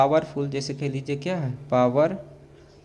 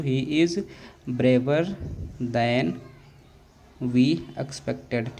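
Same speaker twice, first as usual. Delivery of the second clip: slow dictation; much slower than usual. Shown as a demonstration. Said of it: "क्या लिखेंगे प्यारे बच्चों".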